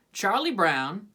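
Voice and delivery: usual speaking voice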